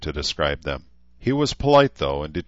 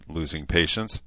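ordinary delivery

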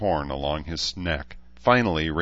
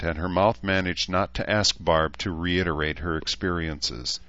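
ordinary delivery

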